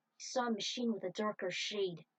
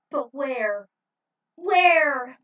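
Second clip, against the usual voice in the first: anguished cry; 5 semitones higher, 16 dB louder, much slower than usual. Acted. Tinsel crying out for Rick.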